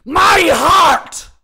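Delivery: anguished full-force shout, high; slow, building bellow of rage; raging. A narrator's reading. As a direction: roar out grief and fury. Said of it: "threw his head back and raged".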